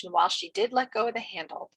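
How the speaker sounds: ordinary delivery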